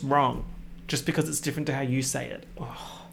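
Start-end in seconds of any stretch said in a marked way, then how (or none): none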